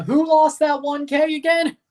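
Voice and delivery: high, loud mock Australian accent; playful Australian impression; fake Australian accent. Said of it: "a good Australian accent".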